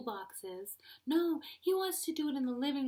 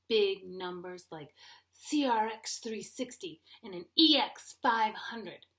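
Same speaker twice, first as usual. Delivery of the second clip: ordinary talk, nothing marked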